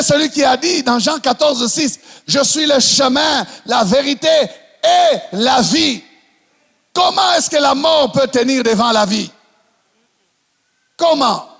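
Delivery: full-force sermon delivery; fiery preaching. Acted. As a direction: rouse the congregation. Preaching climax.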